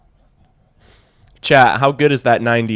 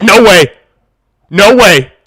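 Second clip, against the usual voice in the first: much higher, much louder disbelieving yell; shocked shout; 8 semitones higher, 16 dB louder than usual. Real, not acted. Streamer shouting at a near home run from Vladdy.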